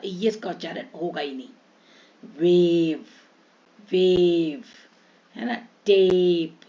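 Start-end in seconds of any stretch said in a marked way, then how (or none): 2.4-4.6 stretched, slightly louder "वेव"; long vowel. Shown as a demonstration. Teacher showing children the silent-e rule, long vowel. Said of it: "इसका उच्चारण लंबा हो जाएगा". 5.9-6.6 "टेप" with a long drawn-out ए and silent e; long vowel. Shown as a demonstration. Teacher demonstrating silent e to children, stretched vowel. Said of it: "इसका उच्चारण लंबा हो जाएगा".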